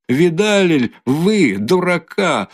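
high, slow, scornful taunt; mocking. A narrator's reading. Katerina jeering at Luzhin to the whole room. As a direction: jeer it to everyone.

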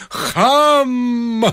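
a high, sharp shout; the locksmith yelling after the procession; shouted insult. Acted. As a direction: fling the word after them. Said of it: "закричал Виктор Михайлович".

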